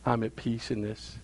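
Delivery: soft, slow and settled, trusting calm; calm at peace. Pretend. Believer answering the storm, serene and softly slow. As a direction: let it rest in quiet trust. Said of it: "have you stood there at peace and say".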